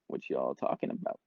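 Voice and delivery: lightly put-on low, lazy mumble from bed; low-voiced question, half out of it; imitating tripping self. Tripping friend in bed asks the sister, imitating a low, dopey voice. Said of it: "that's exactly how I sounded".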